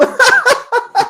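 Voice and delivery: squeaky high laugh. Real, not acted. Laughing at own impression.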